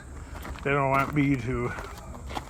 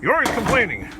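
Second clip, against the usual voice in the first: higher mocking voice, nagging; mock critic voice; 7 semitones higher, 4 dB louder, faster than usual. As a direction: scold him in a high nagging tone.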